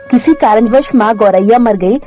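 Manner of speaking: usual speaking voice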